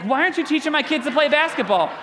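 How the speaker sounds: angry parents confronting the party worker, mock indignant. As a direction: hurl it like offended parents. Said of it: "they were like".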